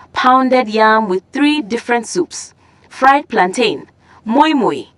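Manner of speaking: ordinary delivery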